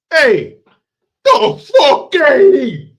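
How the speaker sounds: very high, loud, drawn-out comic wail; mock yell. Comic as agent chasing people off, high mock yell. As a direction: screech it over the top. Mock yelling the film line again.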